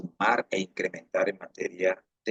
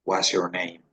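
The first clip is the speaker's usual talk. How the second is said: smooth rapid demo. Shown as a demonstration.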